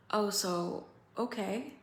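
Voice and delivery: ordinary talk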